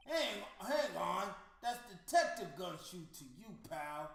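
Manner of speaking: loud, tough cop insisting on his title; gruff detective voice. Acted. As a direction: bark it like an offended detective.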